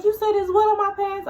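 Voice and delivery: high, loud alarmed question; panicked question. Retold or imitated. First-time mom panics, asking the lady what's on her pants. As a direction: blurt it out in a panic. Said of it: "I was panicking".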